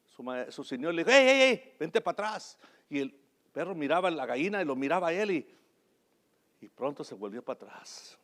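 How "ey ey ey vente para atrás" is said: high, loud warning call; urgent shout to get back; owner shouting at dog. Retold or imitated. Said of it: "le gritó el".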